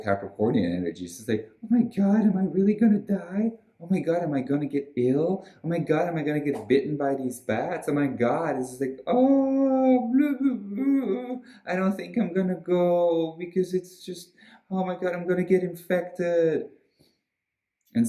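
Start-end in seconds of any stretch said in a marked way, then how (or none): 1.6-8.6 high-pitched, hammed-up panic; mock scared. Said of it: "so now you're scared". 9.1-16.7 very high, hammed-up wail of dread; mock scared. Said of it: "so now you're scared".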